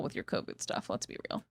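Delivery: breathy aside; whisper. Real, not acted. Whispering a jab at her husband. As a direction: say it under your breath.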